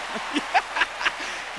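high wheezing laugh; high breathless laugh. Real, not acted. Preacher laughing at his own joke, high breathless laugh.

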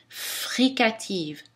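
"Fricative" slow, the F held; stressed first consonant. Shown as a demonstration. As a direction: linger on the F of "Fricative". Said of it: "la première consonne de chacun de ces mots". (appuyer sur le F)

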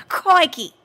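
high-pitched put-on Aussie crikey; Australian accent. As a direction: exclaim it like a stage Australian. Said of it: "what did you think of my accent".

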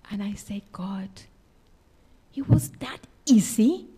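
hushed, breathless whisper to God. Retold or imitated. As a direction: whisper it in disbelief. Whispered prayer of amazement.

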